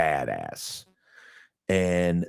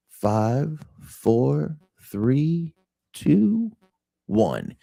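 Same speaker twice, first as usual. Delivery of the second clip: countdown; 8 semitones higher, much slower than usual. Real, not acted. Countdown while loading picture.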